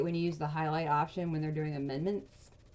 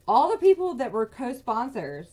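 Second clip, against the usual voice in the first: louder and higher, outraged; real anger; 4 semitones higher, 8 dB louder than usual. Real, not acted. Pointing out the co-sponsors to viewers, angry and loud. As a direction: push it out like you can't believe it. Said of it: "this is making me even more mad".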